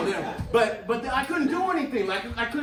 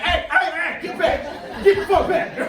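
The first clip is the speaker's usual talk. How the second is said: loud raised shout to back off; yelling warning; 11 semitones higher, 10 dB louder than usual. Retold or imitated. Comedian yelling at the woman to get back, loud. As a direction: bark it to stop her. Speaker yells at attacking woman.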